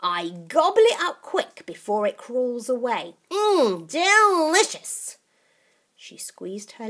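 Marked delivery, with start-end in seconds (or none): 0.0-3.9 put-on old witch voice. Grandma relishing bugs. 3.9-5.2 higher, slow gleeful relish; gleeful old witch. Acted. First take of delicious.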